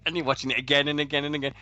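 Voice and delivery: ordinary delivery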